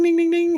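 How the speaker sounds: high sing-song ding like a little bell; bell imitation. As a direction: chime the dings up high like a bell. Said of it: "when the bell goes ding".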